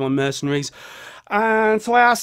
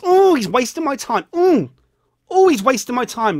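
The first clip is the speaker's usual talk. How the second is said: higher mocking whinge of a viewer; mock whine; 6 semitones higher, 4 dB louder than usual. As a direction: parody the complaining commenter, a bit higher. Mocking imagined viewer complaint.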